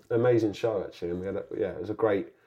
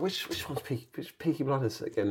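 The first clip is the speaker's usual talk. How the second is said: high, softer puzzled question; lightly acted bewilderment, pitched up; retold confusion; 4 semitones higher, 7 dB quieter than usual. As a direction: replay being baffled on the phone. Retells his confusion to agent.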